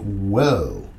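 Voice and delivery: low, drawn-out astonished whoa; mock amazed whoa. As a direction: play your own stunned reaction, low and slow. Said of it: "I'm like".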